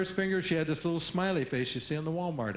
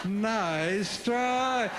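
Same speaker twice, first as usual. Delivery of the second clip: grinning nice try; 6 semitones higher than usual. Shown as a demonstration. Teacher answering a kid.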